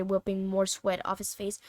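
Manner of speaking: ordinary delivery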